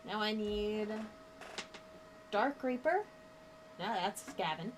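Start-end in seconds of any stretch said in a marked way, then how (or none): none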